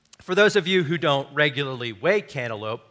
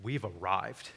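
low, soft proud declaration; mock self-importance; 8 semitones lower, 11 dB quieter than usual. Preacher mocking young farmers' pride in cantaloupe, low and soft. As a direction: drop it low and quiet, mock-grand. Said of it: "and we're like".

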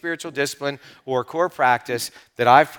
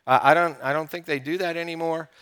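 a much softer, offhand mock of doubters; dismissive people; 6 dB quieter than usual. Imitating people who shrug off fasting, softly dismissive. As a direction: toss it off like an old-fashioned idea. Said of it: "and they go".

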